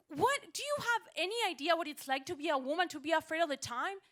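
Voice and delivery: high, loud indignant rant; outraged. Acted. Woman telling the driver about being afraid, outraged. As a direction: let him have it, loud and high.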